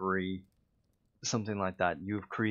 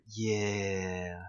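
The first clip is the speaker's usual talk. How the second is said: impression of Mac. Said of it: "that was a terrible impression".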